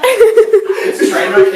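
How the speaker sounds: laughing. Real, not acted. Laughing at failed take.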